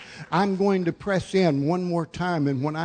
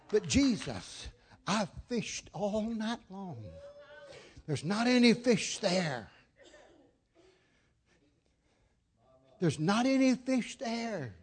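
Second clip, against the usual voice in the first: Peter; 3 semitones higher, 6 dB quieter than usual. Retold or imitated. Weary fisherman objecting to Jesus, mild acted protest. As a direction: plead softly, stretching the key words.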